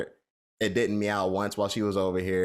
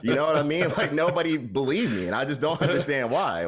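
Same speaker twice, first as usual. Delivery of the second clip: excited laughing; 7 semitones higher, 4 dB louder than usual. Real, not acted. Laughing while insisting nobody believed.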